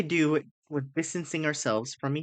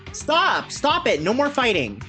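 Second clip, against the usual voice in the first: high, loud desperate cry; desperate shouting; 8 semitones higher, 4 dB louder, slower than usual. Acted. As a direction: scream at them to stop fighting. Character begs fighting to stop.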